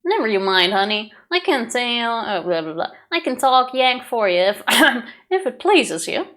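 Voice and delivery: put-on wandering mixed accent, British to southern. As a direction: drift between accents mid-line. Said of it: "the most international accent possible".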